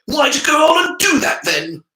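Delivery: high, loud indignant shout; outraged shout. A narrator's reading. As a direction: yell at the betrayal. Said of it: "with fierce disappointment".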